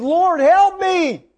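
high, drawn-out imitated plea; crying out. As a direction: wail it slowly like a desperate woman. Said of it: "she cries out more".

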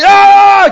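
sudden high loud yell "Ah!"; angry scream. Retold or imitated. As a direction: burst out screaming in their face.

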